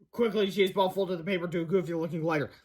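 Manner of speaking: ordinary delivery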